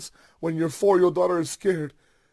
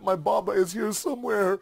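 put-on higher-pitched, urgent child's plea; frantic calling out; 7 semitones higher than usual. A narrator's reading. Little girl wakes from dream calling for her father, frantic. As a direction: plead insistently, pitch climbing with each cry. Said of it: "she begins to frantically call out".

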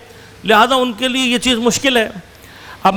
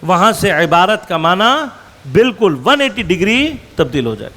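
usual speaking voice

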